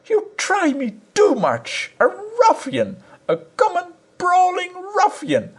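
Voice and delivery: a high, scornful scolding wife; angry wife. A narrator's reading. Wife to her husband, a high scornful tirade.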